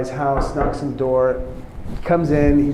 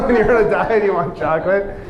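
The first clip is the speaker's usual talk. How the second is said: laughing, quick higher-pitched repeat; amused disbelief; 5 semitones higher, 5 dB louder, faster than usual. Real, not acted. Teacher echoing a student's chocolate answer, amused disbelief. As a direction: repeat it louder through a laugh.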